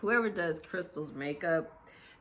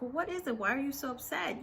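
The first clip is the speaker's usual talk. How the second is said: imitated concerned questioning; 9 semitones higher, faster than usual. Kyle asks Sutton why she is upset, high imitated questioning. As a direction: lift the pitch as you mimic probing.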